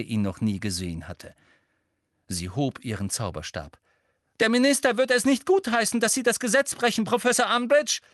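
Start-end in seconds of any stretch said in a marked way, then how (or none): 4.4-8.0 hoher, lauter, drängender Protest; rufend. A narrator's reading. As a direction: ruf es in einem Schwung hoch heraus. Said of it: "rief Hermine". (laut protestierend gerufen)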